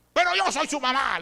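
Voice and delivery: a hint of an indignant mother; mother insisting she is still in charge; imitating the mother. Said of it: "le dice la señora". (la madre, un poco indignada)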